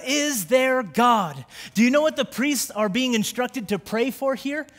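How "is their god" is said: higher and louder, a pleading cry to the congregation; preaching at full force. Acted. Preacher voicing priests who plead, building to a shout. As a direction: pastor reading Joel to the church, crying out at full force.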